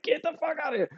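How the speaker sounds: high-pitched strained mock scream, held back in volume; retold inner scream. Soldier screaming in his head in the hot hatch, mock scream. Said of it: "just screaming, like".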